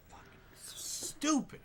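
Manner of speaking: breathy whispered swearing, slow; whispered curse. Real, not acted. Gamer whispering a curse at his own driving. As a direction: hiss it under your breath in disgust.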